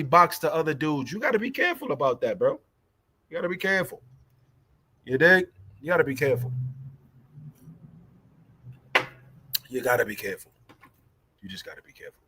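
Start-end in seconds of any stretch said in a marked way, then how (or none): 5.8-12.2 repeated warning fading from plain to almost inaudible. Real, not acted. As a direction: let each repeat sink softer until barely there. Repeating warning, trailing off softly.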